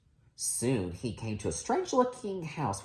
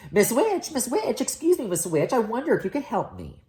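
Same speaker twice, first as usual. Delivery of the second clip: high, bright pleading call for the witch; high-pitched eager prince; 9 semitones higher than usual. Acted.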